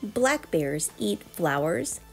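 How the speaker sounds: plain reading voice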